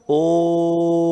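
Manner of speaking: ओम held long on the ओ in one breath; drawn-out Om. Shown as a demonstration. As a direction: chant ओम in one long flow. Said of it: "तो ऐसे बोलूंगा क्या".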